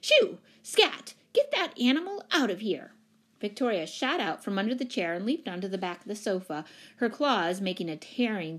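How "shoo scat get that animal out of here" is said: high, loud shooing shout. A narrator's reading. Missus Pennypacker charging at the cat, shouting. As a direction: shoo the animal away with a shout. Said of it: "flapping her skirts and shouting".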